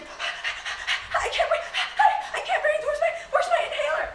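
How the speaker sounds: high, loud panicked gasping, half laughing; mock panic. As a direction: imitate a panicking kid who can't breathe. Panicked kids imitated.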